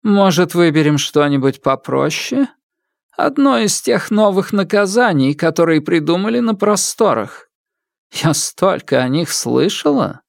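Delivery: high, musing and cruel; cruel widow. Acted. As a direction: savor choosing a punishment. Widow muses on punishment.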